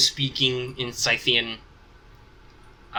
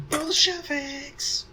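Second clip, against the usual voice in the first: mock yell; much slower than usual. Game master voices an attacker who comes yelling, high mock yell. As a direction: stretch it out as a high-pitched battle cry. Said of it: "comes yelling".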